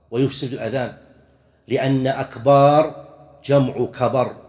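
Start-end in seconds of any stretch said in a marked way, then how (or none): 2.1-3.0 "أكبار" drawn out on the baa; stretched ba. Shown as a demonstration. Teacher explaining the wrong word, "أكبار" with long aa. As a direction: say "أكبار" with a long second vowel, "akbaar". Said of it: "مد الباء".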